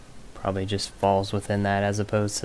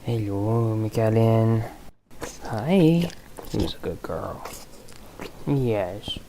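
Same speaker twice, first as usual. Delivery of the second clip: talking to a dog; slower than usual. Real, not acted. Greeting pet mid-game.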